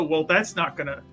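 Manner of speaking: normal talking voice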